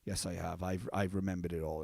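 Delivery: put-on low, soft, weary voice of the old sheep. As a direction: sink low and quiet like an old sheep remembering. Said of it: "And he goes".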